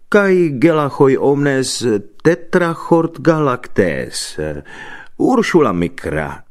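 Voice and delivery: slow, loud, solemn recitation; declaimed Greek. A narrator's reading. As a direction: proclaim each foreign word grandly, drawn out. Declaims Greek words to the apostle.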